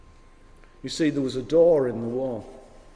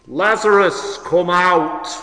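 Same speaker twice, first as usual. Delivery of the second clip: loud, raised summons; calling out; 7 dB louder, slower than usual. Acted. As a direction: call someone out of a tomb. Jesus calling into the tomb.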